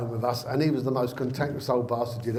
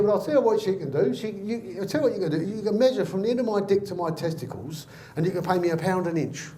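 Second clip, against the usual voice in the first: indignant counter-offer; 7 semitones higher than usual. Acted. Granddad dictates terms to the official.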